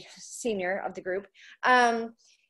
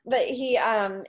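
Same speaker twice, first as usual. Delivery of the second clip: ordinary talk, nothing marked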